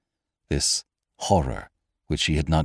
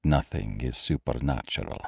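low, soft, measured doctor; grave doctor; 5 semitones lower, 6 dB quieter than usual. A narrator's reading. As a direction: answer quietly and low, with authority.

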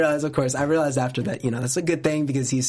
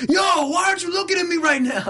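very high, hammed-up yell; mock demanding shout; 4 dB louder than usual. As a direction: imitate an indignant photographer shouting. Acts out demanding photographer, contrasted with polite.

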